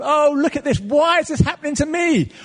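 high, loud, whining complaint; a hammed-up wail of why; mock complaining. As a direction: play the self-pitying moaner. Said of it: "to moan or com".

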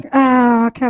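normal talking voice

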